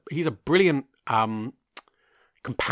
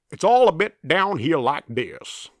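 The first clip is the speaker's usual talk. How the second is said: slow, higher, louder Southern US drawl; comical Southern drawl; 4 semitones higher, 7 dB louder than usual. Acted. Host imitating Daniel Craig's private investigator, comical Southern drawl. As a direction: lay on a comical, stretched-out Southern accent. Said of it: "with an almost comical southern drawl".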